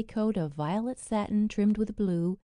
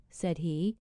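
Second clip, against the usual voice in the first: ordinary delivery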